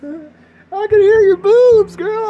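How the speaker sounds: very high, loud, slow shriek; shrieking mock creep. Radio host leering at a caller in a high loud screech. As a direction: stretch it out in a silly screech.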